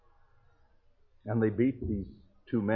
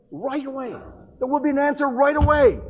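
preaching climax; 12 semitones higher, 9 dB louder than usual. Acted. Preacher's climax on prayer answered.

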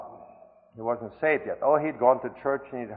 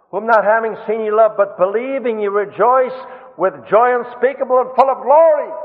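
higher and louder, preaching the verse; fervent proclamation; 8 semitones higher, 8 dB louder than usual. Acted. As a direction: proclaim it with full preacher force. Same verse repeated with fervour.

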